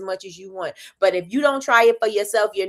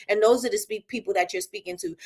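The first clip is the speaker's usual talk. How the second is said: ordinary talk, nothing marked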